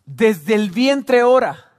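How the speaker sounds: weighty pulpit declaration; emphatic proclamation. Real, not acted. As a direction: proclaim it slowly to the congregation. Preacher proclaims a key phrase.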